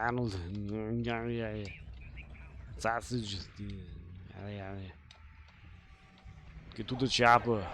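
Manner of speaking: low action-hero impression; Arnold Schwarzenegger. Player trying out catchphrases, low Arnold impression. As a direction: drop low into a movie-star catchphrase voice. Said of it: "I can do an Arnold voice".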